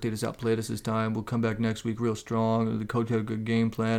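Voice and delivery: low, soft droning athlete cliché; droning quarterback talk. Retold or imitated. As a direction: drone it like a bored quarterback. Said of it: "droning quarterback talk like".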